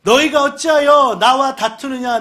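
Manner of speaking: a prophet scolding his people; Moses rebuking. Retold or imitated. Moses rebuking the quarrelling people, slow and stern.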